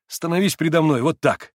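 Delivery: громко и резко; позвать громко и требовательно; громкий оклик. A narrator's reading. Митя вдруг кликнул Алёшу. (Митя громко окликает Алёшу)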